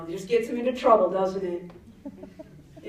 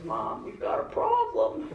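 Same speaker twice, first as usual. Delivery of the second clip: put-on higher, slow, meek kid voice; sheepish son; 14 semitones higher, 3 dB quieter, slower than usual. Son confessing his hand is hurt, meek and slow. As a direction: sound small and guilty. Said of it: "and he goes".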